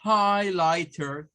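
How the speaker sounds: highlighter said slowly with the r; American highlighter. Shown as a demonstration. Teacher showing how simple highlighter sounds. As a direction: draw out highlighter, ending in a clear r. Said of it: "Solo debemos de decir highlighter".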